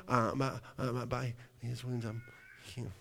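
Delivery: very soft, halting mock-feeble voice; acted fading confidence, almost inaudible; weak, faltering. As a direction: barely get it out under pressure. Acts believer faltering under increased symptoms.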